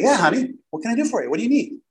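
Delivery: eager, high-pitched welcome; excited. Retold or imitated. Husband greeting his wife at the office door, excited. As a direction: sound delighted to help, pitch lifted. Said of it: "actually excited to see her".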